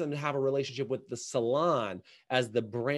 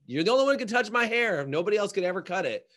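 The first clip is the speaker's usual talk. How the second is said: higher-pitched mock possessive hairdresser; mock possessiveness; 6 semitones higher, 4 dB louder than usual. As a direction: insist like a jealous stylist, hammed up. Acting out a possessive hairdresser's demand.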